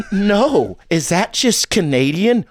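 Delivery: high, sensitive villain voice; Joaquin Phoenix's Joker. Acted. Sensitive Joker questions the other, high and fragile. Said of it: "Joaquin Phoenix in the Jo".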